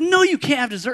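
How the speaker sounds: high-pitched exasperated refusal; retold snapping. Dieting dad snapping at son over dessert, retold snap. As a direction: snap it in mock outrage. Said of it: "I snapped at him".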